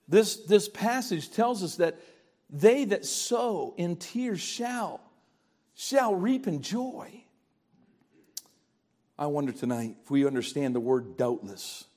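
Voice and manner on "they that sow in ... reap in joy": very slow, quiet scripture line; hushed slow scripture; slow solemn reading. Real, not acted. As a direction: let each word of the verse sink in.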